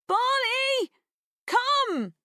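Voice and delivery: high, loud call. A narrator's reading. Girl calling her dog into the trees, loud and high. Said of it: "louder and higher this time".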